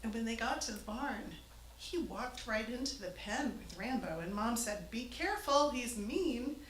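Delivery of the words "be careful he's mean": urgent warning, higher and louder. Retold or imitated. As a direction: warn him off the mean ram. Mom warns the farmer.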